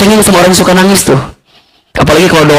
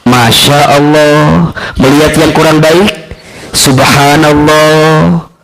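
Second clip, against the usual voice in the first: slow drawn-out phrases; slower than usual. Shown as a demonstration. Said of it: "apa kalimatnya?".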